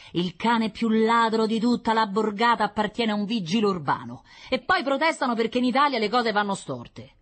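griping Roman shopkeeper; indignant butcher. Acted. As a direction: complain to your wife indignantly. Butcher complains to wife.

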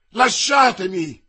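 loud, high, drawn-out shout; sharp outburst at full voice; angry cry. A narrator's reading. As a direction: shout to be let go. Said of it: "gridò lei".

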